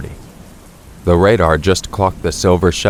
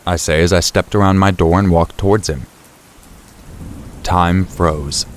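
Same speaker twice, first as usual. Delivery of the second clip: ordinary delivery, nothing marked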